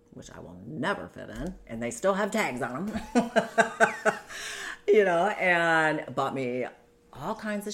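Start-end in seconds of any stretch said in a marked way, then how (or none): 3.3-4.8 rueful laugh. Real, not acted. Bitter laugh over unworn dresses.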